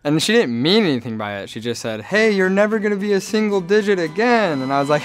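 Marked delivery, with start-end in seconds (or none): 2.0-4.6 a lightly voiced mom, much higher and chirpy; mom's remark, higher. Mom at the tenth birthday, lightly voiced and high. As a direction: tease like a cheerful mom.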